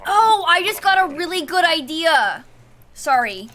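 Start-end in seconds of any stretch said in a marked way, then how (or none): 0.1-2.4 high, loud burst of inspiration; sudden excitement. Real, not acted. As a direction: blurt it out as the idea hits. Sudden idea interrupts the game.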